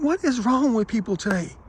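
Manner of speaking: high-pitched exasperated disbelief; flabbergasted. Retold or imitated. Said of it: "And I was flabbergasted".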